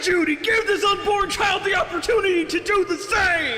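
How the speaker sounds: hammed-up desperate yell; desperate shout. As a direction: beg her at full volume. Nick shouting plea.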